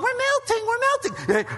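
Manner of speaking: high, whiny mock wail of we're melting; squealing cartoon whine; mock melting cry. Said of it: "they're saying global warming".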